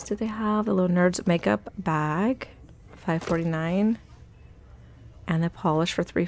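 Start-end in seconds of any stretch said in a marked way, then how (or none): none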